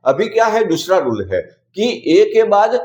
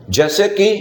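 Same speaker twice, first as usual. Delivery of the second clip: usual speaking voice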